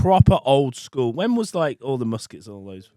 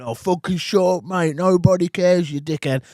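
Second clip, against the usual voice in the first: higher-pitched mock irritation; English idiots heckling; 6 semitones higher than usual. Gamers telling the role-playing kid to stop, mock exasperated heckle. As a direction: imitate fed-up gamers heckling down the mic. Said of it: "these English idiots going".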